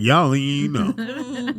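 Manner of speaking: slow, drawn-out mom impression; slow it down like her mom did; imitating mom. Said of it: "she said just like".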